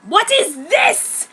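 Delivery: sharp, angry demand. A narrator's reading. Girl angrily confronting her wolf-eared disguise, angry. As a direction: snap it out in anger. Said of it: "very angry".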